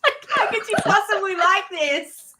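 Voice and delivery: high, drawn-out, laughing protest; mock dismay breaking into laughter; laughing disbelief. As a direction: stretch it out high, laughing at your own accent. Retold disbelief about own accent.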